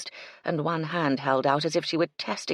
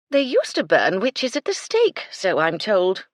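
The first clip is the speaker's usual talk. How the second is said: louder, higher cutting retort; 5 semitones higher, 8 dB louder than usual. A narrator's reading. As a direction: fire it back, loud and high. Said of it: "she retorted".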